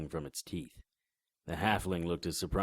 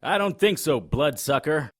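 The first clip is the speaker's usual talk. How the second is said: loud, high yelling; angry shouting; 11 semitones higher, 13 dB louder than usual. Acted. Fighting a vampire while cops shoot at him, angry shouting. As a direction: shout over the gunfire.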